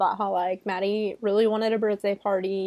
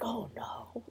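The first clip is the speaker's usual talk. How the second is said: hushed, mock-horrified aside; barely voiced, as the penny drops; dawning realization; 2 semitones higher, 16 dB quieter than usual. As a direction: play a dread-filled realization very softly. Detective's dawning realization, retold.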